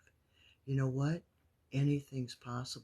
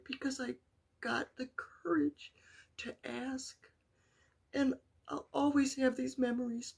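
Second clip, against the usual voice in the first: higher, strained voice holding back tears; real tears rising over a mother's words; near tears; 10 semitones higher than usual. Real, not acted. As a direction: speak through welling emotion. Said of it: "not gonna end this Facebook Live crying".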